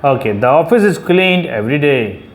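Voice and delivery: ordinary talk, nothing marked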